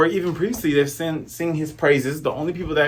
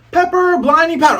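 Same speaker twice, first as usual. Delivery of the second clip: high, loud battle cry of an anime attack; shouted attack name; 13 semitones higher, 6 dB louder than usual. Retold or imitated. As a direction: yell the move name like a cartoon kid. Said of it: "The Usopp Pirates has a name attack".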